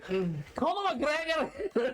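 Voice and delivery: drawn-out, high, hammed-up Scottish accent; mock a terrible Scottish accent, stretching the name; high-pitched fake Scottish call-out; bad put-on Scottish accent. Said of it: "the accent sucked".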